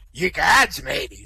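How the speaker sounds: lightly voiced pirate, startled. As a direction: exclaim it with a touch of old sea dog. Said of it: "Two pirates, Morty and Sol".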